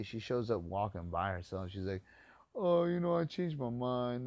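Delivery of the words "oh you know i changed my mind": high, loud prissy mom voice; mock the flaky mother's excuse. Teacher mimicking the flaky mom, high and loud.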